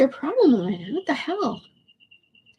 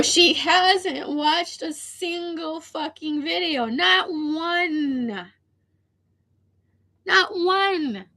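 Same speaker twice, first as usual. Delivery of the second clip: slow, tearful words through real crying; 5 semitones higher, much slower than usual. Real, not acted. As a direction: let the tears break through, slowly. Upset venting about uncaring friend.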